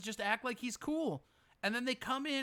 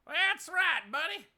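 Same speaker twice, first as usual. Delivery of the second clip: mock cheerer; 8 semitones higher, slower than usual. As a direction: echo the rally guy's line.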